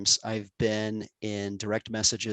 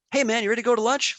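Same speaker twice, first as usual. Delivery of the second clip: much higher, quick and carefree; breezy mentor; 13 semitones higher, 5 dB louder, faster than usual. Retold or imitated. Mentor casually invites him to lunch, lightly voiced breezy. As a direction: toss it off like nothing happened.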